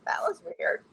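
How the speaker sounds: breathy whisper to herself; whispered thought. Retold or imitated. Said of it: "I was like".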